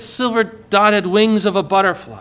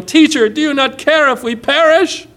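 raised, louder panicked plea; frightened cry; 8 semitones higher, 4 dB louder than usual. Acted. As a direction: beg like men about to drown. Disciples cry out in storm.

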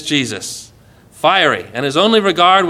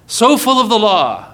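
raised, emphatic preaching voice; forceful; 3 semitones higher than usual. Real, not acted. Preacher setting law against grace, forceful. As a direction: hit it hard, like a verdict.

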